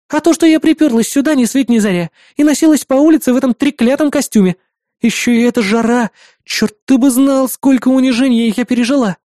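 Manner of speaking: high, aggrieved outpouring; worked-up rant. A narrator's reading. Partner venting about the costume and heat, worked up. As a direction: vent the grievances, pitched well up. А ты чего на взводе.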